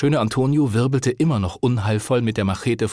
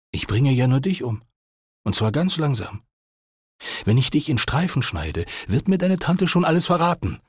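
Antonio's threat, slightly raised in pitch; lightly voiced menacing thug; 5 semitones higher than usual. A narrator's reading. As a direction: lightly play a man threatening murder.

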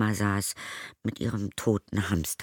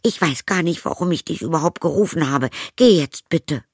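high, loud, upset outburst; angry girl; 9 semitones higher, 7 dB louder than usual. Acted. Zoe angrily sends her stepmother away. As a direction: push her out the door, furious. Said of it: "fuhr Zoe sie an".

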